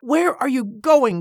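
high, slow indignant question; demanding. A narrator's reading. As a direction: demand an answer, drawing the words out. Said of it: "Cornelia demanded".

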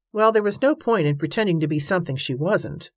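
usual speaking voice

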